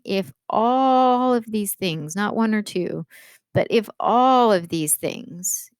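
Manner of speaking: ALL drawn out slowly and hit hard; slow emphatic all; stress on ALL. Shown as a demonstration. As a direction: lean on the word all, slow. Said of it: "It's like he's saying".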